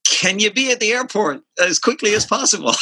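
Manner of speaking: a lightly voiced urgent request; imitating Rob. Producer calling with an urgent request: high urgent quote. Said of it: "he's like".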